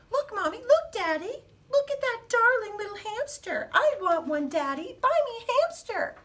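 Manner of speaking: put-on high-pitched, loud begging child; pleading child. Child at the hamster cages pleading with parents, high and eager. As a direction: beg like an excited little kid.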